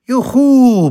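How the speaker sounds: put-on very high, loud joyous child whoop; ecstatic yell. Boy whoops with joy before storming out, loud ecstatic cheer. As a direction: shriek with pure delight. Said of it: "brüllte Lorenzo ... in höchster Begeisterung".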